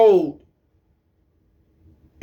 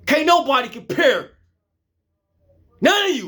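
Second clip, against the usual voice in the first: defiant outburst; 7 semitones higher than usual. Real, not acted. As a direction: throw it at them, nobody compares. Peak of the heated rant.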